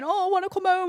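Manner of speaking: high, whining mock complaint; whiny girly voice. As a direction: whine it like a homesick girl. Imitating her own whiny TikTok.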